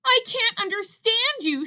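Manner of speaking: high-pitched exasperated cry; shouted. A narrator's reading. Rabbit unable to follow Ribbit, shouting shrilly. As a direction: cry out in frustration. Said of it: "shouted Rabbit".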